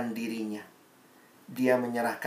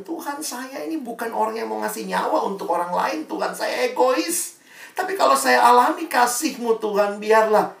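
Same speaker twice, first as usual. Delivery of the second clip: high, pleading prayer building louder; earnest prayer; 9 semitones higher, 4 dB louder than usual. Acted. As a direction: pray it like a confession. Preacher prays aloud. (doa yang tulus dan memohon)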